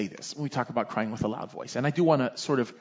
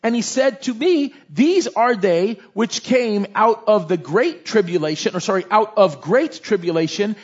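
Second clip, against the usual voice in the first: slow, raised, ringing Bible reading; louder, deliberate preacher's recitation; scripture reading; 6 semitones higher, 5 dB louder than usual. A narrator's reading. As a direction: proclaim each phrase with weight. Reads scripture aloud to congregation.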